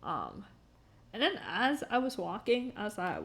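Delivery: ordinary talk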